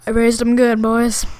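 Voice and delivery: put-on loud boastful brag to the boys; hammed-up proud yell; proud shout.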